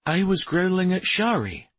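high-pitched, dismayed question; appalled disbelief; dread. A narrator's reading. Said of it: "with an expression of dread on his face".

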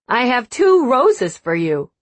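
nervous voice breaking on the words; voice cracking. Acted. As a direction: let the voice crack with nerves. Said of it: "his voice cracking".

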